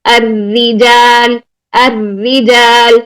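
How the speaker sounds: الرجال drawn out slowly with a light tap; slow tajweed recitation. Shown as a demonstration. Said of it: "one سے ٹو ٹائمز کی تکرار".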